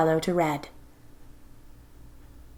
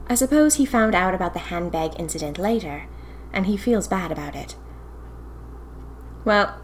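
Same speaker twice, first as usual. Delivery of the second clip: plain reading voice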